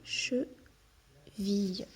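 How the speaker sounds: Cheville drawn out very slowly and softly, each syllable separate; slow soft model word; stretched slowly. Shown as a demonstration. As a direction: stretch out Cheville gently for the child to hear. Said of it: "Il nous manquait vie".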